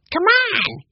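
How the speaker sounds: put-on high, loud, excited boy; eager child's call; speaking up eagerly. A narrator's reading. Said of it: "Benny spoke up".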